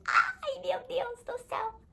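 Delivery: very high-pitched, slow delighted squeal; overjoyed squeal. Retold or imitated. Fan reliving her joy at the film, overjoyed squeal. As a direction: re-enact a drawn-out, thin fangirl squeal of joy. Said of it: "eu fiquei feliz demais".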